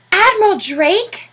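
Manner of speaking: high, slow astonished gasp; gasped in surprise. A narrator's reading. Popper, stunned, gasps the Admiral's name back. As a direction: breathe the name in disbelief. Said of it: "gasped Mister Popper".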